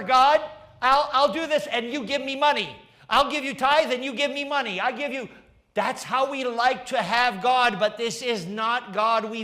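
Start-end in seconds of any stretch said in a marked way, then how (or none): 0.0-5.3 high, loud, fast mock believer bargaining with God. Preacher mocks a believer bribing God like a dog, higher and louder. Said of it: "worship God as if he is Rover".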